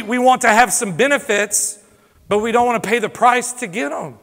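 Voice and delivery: ordinary talk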